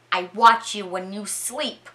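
lightly menacing retold captor; mock-creepy confession, deliberately spoken; creepy accusation. Storyteller voices Howard accusing the captives, mildly creepy.

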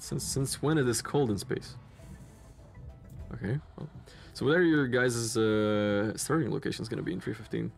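normal talking voice

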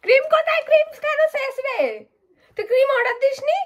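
high urgent complaint about the cream; panicked whine. Real, not acted. Panicking over missing cream.

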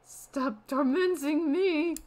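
high, loud, drawn-out wail; overacted tearful plea; mock weeping. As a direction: wail it slowly in hammed-up anguish. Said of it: "You weep, unable to control your emotions".

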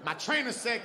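normal talking voice

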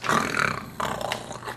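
low, rasping mock snoring; fake snore. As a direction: snore as if bored to sleep. Fakes falling asleep at match.

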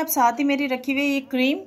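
ordinary talk, nothing marked

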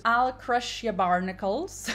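put-on slow, growled pirate accent on "I'll crush your barnacles"; silly pirate accent. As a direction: play a mock sea pirate threatening, slowly. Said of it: "I don't know why I did that accent".

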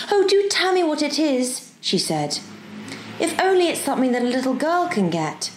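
put-on high, eager child's voice; eager helpful girl. Girl coaxing the professor, eager. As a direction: plead to be allowed to help, pitched high. Diana's voice in the story.

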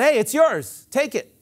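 high, loud cheerful offer; friendly offer. Retold or imitated. Preacher playing the giver, offering the gift cheerfully. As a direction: hand it over brightly, hey take it.

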